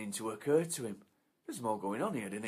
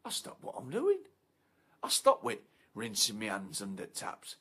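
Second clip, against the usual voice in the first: ordinary talk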